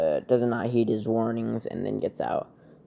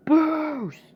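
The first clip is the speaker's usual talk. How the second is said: very high, drawn-out mock cry; acted battle cry; 4 dB louder than usual. Fighter calling out his power move, acted battle cry.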